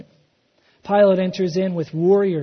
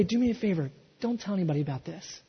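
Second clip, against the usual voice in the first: hushed request; 9 dB quieter, faster than usual. Retold or imitated. Jesus asks for secrecy.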